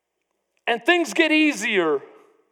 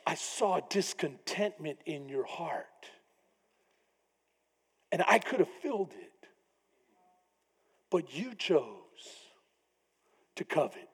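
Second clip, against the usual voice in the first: soft rebuke; 9 semitones lower, 13 dB quieter than usual. Acted. God quietly rebuking David for coveting, soft and low. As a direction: hush it down like a grieved father. Voicing God's rebuke gently.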